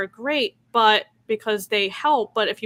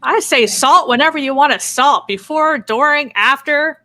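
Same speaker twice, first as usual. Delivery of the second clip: emphatic loud; 5 semitones higher, 10 dB louder than usual. Real, not acted. Emphatic loud opinion on salting.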